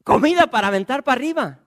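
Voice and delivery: excited yelling across the wall; shouting lepers. Acted. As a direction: call out the news to the gate.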